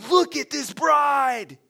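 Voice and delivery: sharp gasp into a high, loud exclamation; gushing awe. Acted. Guest ignoring Bill to admire the bride, gushing awe. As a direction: gush over the bride, hammed-up and drawn out.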